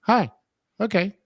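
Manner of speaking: high squawky phone-call copy; parrot phone mimic. Parrot copies dad on the phone, high mimic. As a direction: chirp the phone lines like a parrot. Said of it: "the bird would say that".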